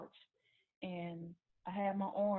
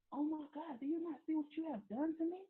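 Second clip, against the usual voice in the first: retold distraught plea; 8 semitones higher than usual. Wounded woman confronting her boyfriend, retold distraught plea. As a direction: re-enact a shocked plea in a raised pitch.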